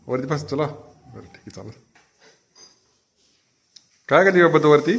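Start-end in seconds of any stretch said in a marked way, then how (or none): 4.1-4.9 put-on high, coaxing baby talk to a cat; pet talk. Owner coaxing the cat Mau, high baby talk. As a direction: coo at the kitten, raised voice.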